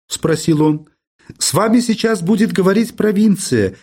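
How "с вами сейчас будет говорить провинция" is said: high-pitched telephone operator; lightly voiced woman on the line, pitched up; a hint of a switchboard woman, higher voice; female operator voice. A narrator's reading. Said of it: "тихо с шипением отозвалась трубка женским голосом".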